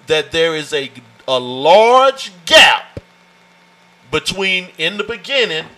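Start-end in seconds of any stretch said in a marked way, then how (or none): 1.3-3.0 "a large gap" drawn out slowly and loud; stretched emphasis. Shown as a demonstration. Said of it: "how imperative this is".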